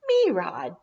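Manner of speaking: lightly voiced little kid, eager; a hint of a small child pleading; toddler begging. A narrator's reading. Tyler jumping, begging for rides, lightly voiced eager toddler.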